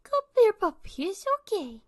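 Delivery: very high, cooing puppy voice; baby-talk soothing. Retold or imitated. Dog lover comforting an upset puppy, high cooing.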